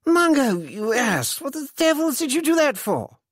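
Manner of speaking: high-pitched exasperated scolding; indignant outburst. A narrator's reading. Freddy berates his friend over the lost taxi, indignant.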